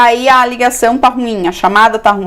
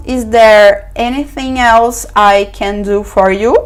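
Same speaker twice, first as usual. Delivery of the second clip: slow model phrase; slower than usual. Shown as a demonstration. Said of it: "Outra forma".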